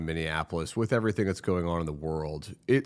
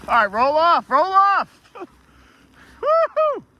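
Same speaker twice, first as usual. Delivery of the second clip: excited yell; 10 dB louder, much slower than usual. Real, not acted. Cheering sled run.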